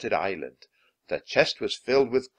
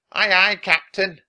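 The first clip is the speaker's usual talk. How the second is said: pirate crew reply; 10 semitones higher, 10 dB louder than usual. A narrator's reading.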